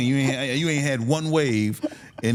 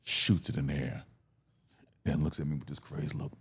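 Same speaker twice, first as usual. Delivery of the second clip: ordinary delivery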